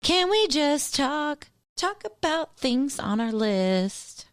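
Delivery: slow, drawn-out teasing plea; mock sing-song plea. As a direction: stretch it out like a nagging mom. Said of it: "The girls don't like it when I start saying that".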